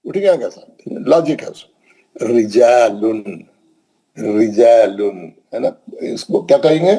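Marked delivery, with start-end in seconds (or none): 2.3-5.0 رجال recited lower with a light thin ra; light ra recitation; thin ra demo. Shown as a demonstration. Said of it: "اگر را پہ زیر ہوگی تو پتلا پڑ جائے گا".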